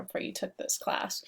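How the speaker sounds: ordinary talk, nothing marked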